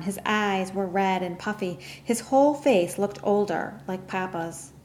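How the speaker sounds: ordinary delivery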